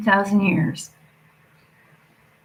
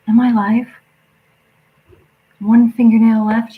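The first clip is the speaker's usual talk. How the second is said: loud; 3 semitones higher, 9 dB louder than usual. Real, not acted. Suddenly checks the stream.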